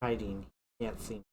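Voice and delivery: hushed. Acted. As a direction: keep it down while hiding. Hiding from the dog.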